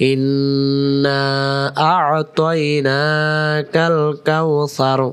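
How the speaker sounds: slow, drawn-out chanted recitation; slow Quran recitation. Shown as a demonstration. Teacher reciting slowly for students. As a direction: intone each syllable slowly and melodically. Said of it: "আমাদেরকে বলতে হবে".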